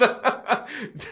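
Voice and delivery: a short burst of real laughter. Real, not acted. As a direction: laugh out, amused. Laughs at own remark.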